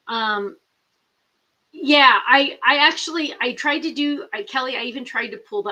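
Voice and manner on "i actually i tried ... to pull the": ordinary talk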